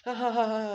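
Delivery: high, loud mocking cackle; mock villain laugh. Bakugo laughing over the beaten Deku, loud cackle. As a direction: laugh it in his face. Bully laughing after taunt.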